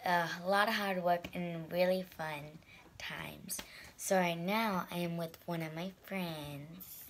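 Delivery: hoarse, lost voice, half whispered; raspy whisper. Real, not acted. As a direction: whisper through a voice that's going. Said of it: "Sorry, I'm losing my voice".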